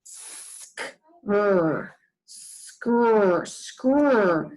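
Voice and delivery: skr repeated as a hissy consonant cluster; teacher drilling the blend; sounding out blend. Shown as a demonstration. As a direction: say skr three times, no vowel. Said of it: "let's sound that out together".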